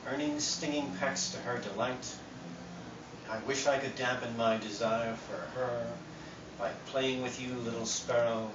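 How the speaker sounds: soft, measured poem recital; poetry reading. Acted. Poet reads sparrow love poem to audience, soft recital. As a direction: read the verse quietly, phrase by phrase.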